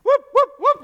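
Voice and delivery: very high, loud whooping scream; imitated screaming whoops. Preacher mimics girl screaming at the cafeteria, loud whoops. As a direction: shriek the whoops like she's overcome. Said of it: "waving her arms and screaming".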